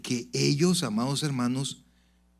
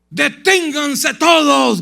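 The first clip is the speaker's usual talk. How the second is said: much higher, much louder slow bellow of "Deténganse todos"; commanding shout; 13 semitones higher, 12 dB louder, much slower than usual. Retold or imitated. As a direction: roar at panicking students to halt. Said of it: "gritó".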